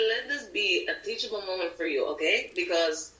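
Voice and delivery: Latin accent. Shown as a demonstration. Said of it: "do it in the Spanish accent".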